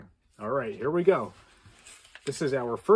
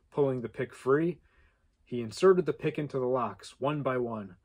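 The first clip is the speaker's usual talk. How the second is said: plain reading voice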